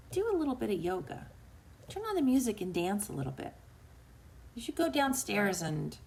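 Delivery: soft, gently suggesting little voice; inner voice. Acted. Host voicing an inner whisper to her listeners, soft little voice. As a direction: quietly nudge with a passing suggestion. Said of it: "I'm gonna hear a voice say".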